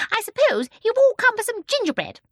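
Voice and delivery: put-on high, loud, excitable little old lady; chatty old woman. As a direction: chatter on like a lively old shopkeeper. Old shopkeeper addresses customer.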